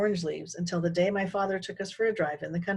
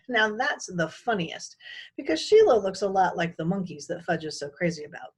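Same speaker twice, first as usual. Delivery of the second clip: plain reading voice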